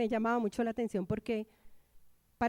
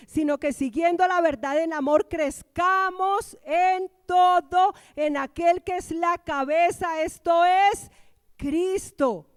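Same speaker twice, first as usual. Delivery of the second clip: loud, raised preacher's voice, stretching key words; forceful preaching; 4 semitones higher, 7 dB louder than usual. Acted. Preacher reading Paul's verse to the congregation, forceful preaching.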